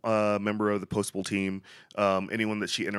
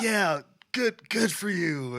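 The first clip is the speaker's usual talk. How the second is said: much higher, chirpy congratulation; Will Ferrell as Buddy the Elf; 9 semitones higher, slower than usual. Retold or imitated. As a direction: pat them on the back like an elf. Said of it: "and then he's like".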